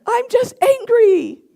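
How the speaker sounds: high, loud, angry protest; re-enacted shrieky-high shouting while denying it; yelling in denial. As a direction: insist indignantly at a comic yell, stretching the last words. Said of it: "I kinda am yelling right now".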